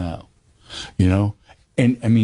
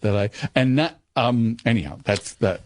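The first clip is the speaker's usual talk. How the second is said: normal talking voice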